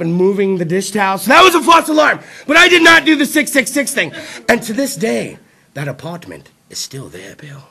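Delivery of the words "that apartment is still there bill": whispered. Real, not acted. Comic closing the ghost story to the crowd, spooky whisper. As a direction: drop to a creepy whisper. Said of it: "whispered aside".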